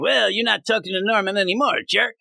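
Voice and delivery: high sneering villain voice; hammed-up Goblin villain taunt; Goblin's taunt. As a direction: sneer it like the Goblin, pitched well up. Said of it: "Norman turns Goblin".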